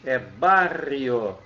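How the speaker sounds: "é barrio." very slow, Spanish r in "barrio"; slow Spanish word. Shown as a demonstration. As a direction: draw out "barrio" without the i. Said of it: "a gente só troca ali, ó, o i".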